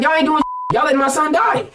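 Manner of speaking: half-shouted retelling of his anger; retold angry yell. Retold yelling at police.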